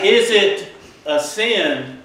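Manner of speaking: ordinary talk, nothing marked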